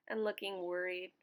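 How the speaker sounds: ordinary delivery, nothing marked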